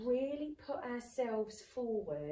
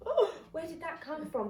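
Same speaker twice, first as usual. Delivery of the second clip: higher, louder, caught off guard by your own boldness; surprised at yourself; 6 semitones higher, 6 dB louder than usual. Retold or imitated. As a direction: gasp at what just came out of you. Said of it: "And you're like".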